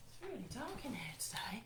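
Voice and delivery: whispered aside; hushed grumble about the dark. Real, not acted. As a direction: whisper it to yourself.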